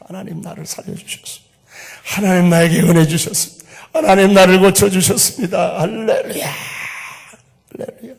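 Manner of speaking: rising to a loud high cry of thanks; ecstatic praise. Retold or imitated. Healed bride crying out thanks, loud and high. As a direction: shout your joy to God, voice lifting.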